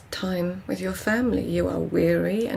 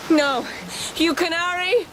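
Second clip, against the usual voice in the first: eerie high call; 11 semitones higher than usual. Acted. Dream voice calling.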